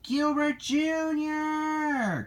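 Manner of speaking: high, drawn-out name call. A narrator's reading. Lassie calls for her missing friend, high drawn-out call. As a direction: stretch the name out high, calling from afar. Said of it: "called a voice".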